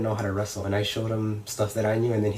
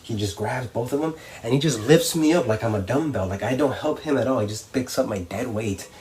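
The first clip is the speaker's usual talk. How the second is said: ordinary delivery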